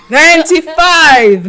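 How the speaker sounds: loud, high, drawn-out exclamation; big stretched-out proclamation, laughing; loud, emphatic. Real, not acted. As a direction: belt it out slowly with a laugh. Repeats louder after being teased.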